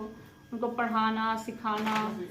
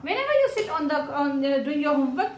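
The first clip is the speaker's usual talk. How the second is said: loud, raised-pitch telling-off; exasperated scolding; 3 semitones higher, 5 dB louder, much slower than usual. Real, not acted. Mother telling off child who avoids homework, exasperated scolding. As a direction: scold at full voice, drawing out the complaint.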